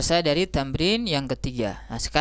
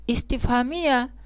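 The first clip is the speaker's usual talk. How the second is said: usual speaking voice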